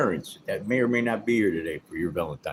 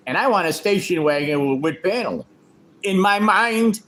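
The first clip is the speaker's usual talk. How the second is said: commanding shout; 6 semitones higher, 4 dB louder than usual. Acted. Acted commanding husband quote.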